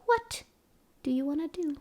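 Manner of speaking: usual speaking voice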